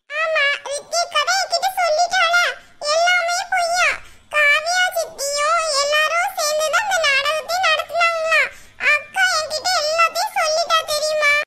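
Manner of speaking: put-on high, bright childlike voice of a small girl; little girl voice. Granddaughter confiding in grandma, high little girl voice. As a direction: play the young granddaughter gossiping eagerly.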